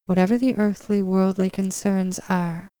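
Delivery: normal talking voice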